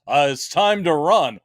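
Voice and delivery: slow, goofy mock character voice; drawn-out buffoonish announcement; silly uncle impression. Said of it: "he goes".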